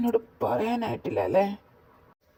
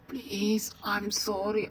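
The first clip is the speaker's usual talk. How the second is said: whispered apology; 5 dB quieter, much slower than usual. Acted. Pleading to crying partner.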